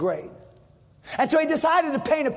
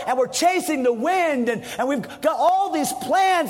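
ordinary talk

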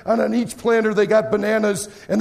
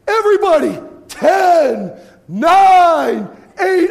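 louder with each number, pitch up; loud countdown; 8 semitones higher, 8 dB louder, much slower than usual. Retold or imitated. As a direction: lead the whole room in counting. Leading crowd countdown.

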